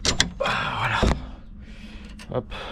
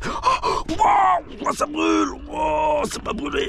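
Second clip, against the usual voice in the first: high-pitched yelp of pain; gasp, then wail high from a burn; real pain; much slower than usual. Real, not acted. Mechanic who just burnt himself, yelping in pain. Burns himself while working on car.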